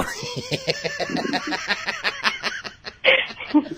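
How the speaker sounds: long high peal of laughter; laughing. Real, not acted. Radio prankster after revealing the prank, laughing.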